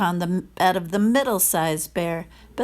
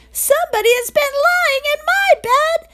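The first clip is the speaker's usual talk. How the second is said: much higher, louder tiny voice with a laugh; little wee bear crying out; 7 dB louder than usual. A narrator's reading. Wee bear finds the girl in his bed, high excited cry. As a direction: squeal it out in shocked delight. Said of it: "But then he cried".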